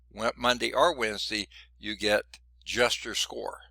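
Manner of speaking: ordinary talk, nothing marked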